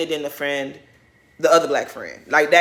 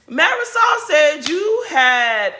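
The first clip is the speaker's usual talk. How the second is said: loud, high, slow exclamation; high-pitched, stretched-out astonished shout; incredulous outburst; 8 semitones higher, 5 dB louder, much slower than usual. Real, not acted. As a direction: shout it drawn out in disbelief. Incredulous outburst recalling the insult.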